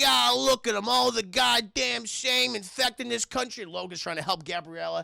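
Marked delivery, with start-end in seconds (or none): 0.0-3.6 high, loud, slow put-on bigot voice; hateful Karen sneer. Motel owner sneering at the guests, slow and high. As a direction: spit it out like a nasty old neighbour. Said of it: "the lady is just like".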